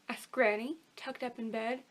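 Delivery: ordinary delivery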